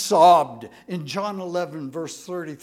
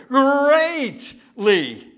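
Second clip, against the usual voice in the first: louder, higher mock Tony the Tiger "greatly"; 6 semitones higher, 7 dB louder than usual. Pastor jokes with a Tony the Tiger "greatly". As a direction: belt "greatly" like a cereal mascot. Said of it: "like Tony the Tiger".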